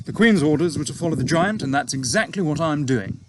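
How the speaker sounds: a hint of a breezy young officer; young pilot. A narrator's reading. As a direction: pilot answering his boss, lightly voiced breezy officer. Pilot voiced in read story.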